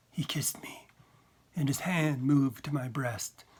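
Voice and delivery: ordinary delivery, nothing marked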